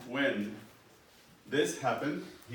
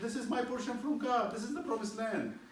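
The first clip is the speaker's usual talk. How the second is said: much higher, earnest plea; earnest Naboth; 12 semitones higher than usual. Acted. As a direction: hold up your God-given portion. Naboth explains his inheritance.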